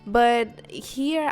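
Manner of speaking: ordinary delivery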